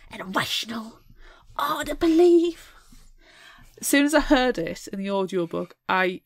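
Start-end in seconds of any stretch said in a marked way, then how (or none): 0.1-2.7 high, loud Cockney; hammed-up Cockney sergeant; mock Cockney gossip. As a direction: relish the gossipy constable line. Performing the sergeant quote.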